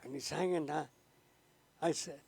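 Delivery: ordinary delivery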